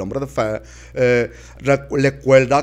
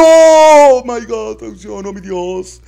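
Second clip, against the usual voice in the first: outcry; 10 semitones higher, 10 dB louder, slower than usual. Acted.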